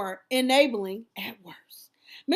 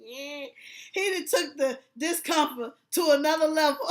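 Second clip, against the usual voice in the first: ordinary talk, nothing marked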